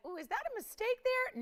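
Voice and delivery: high, bright mock surprise; excited. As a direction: squeal it like you found a steal. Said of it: "you're gonna be singing that again tonight".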